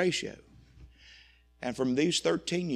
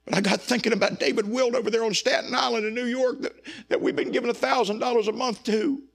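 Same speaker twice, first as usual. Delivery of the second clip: emotional regret; 9 semitones higher, 6 dB louder than usual. Real, not acted. Regret over cutting the church planter.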